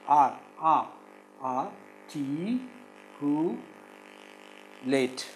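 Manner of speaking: "articulate" in slow isolated syllables; "articulate" with long gaps between syllables. Shown as a demonstration. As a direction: break "articulate" into ar, ti, cu, late. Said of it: "It is four syllables".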